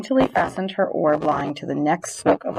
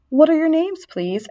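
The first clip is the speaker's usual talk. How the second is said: high, louder eager question; trembling with joy; 9 semitones higher, 3 dB louder than usual. A narrator's reading. Wilbur asks his new friends' names, joyful eagerness. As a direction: start high and keen, drop on please. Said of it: "trembling with joy".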